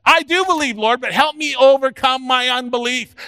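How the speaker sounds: loud, high, impassioned plea; crying out. Retold or imitated. Father begging the Lord against his unbelief, crying out. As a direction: cry out to the Lord for help. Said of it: "he instantly cried out".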